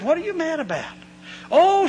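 high-pitched huffy oh; a hint of an outraged church lady; mock indignant exclamation. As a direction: squeal it out like an offended lady. Quarrel with offended church lady.

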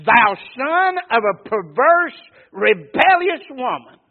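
enraged king cursing his son at full volume; Saul's furious shout. Acted. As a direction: bellow it at your son like a raging king. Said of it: "You think somebody's upset?".

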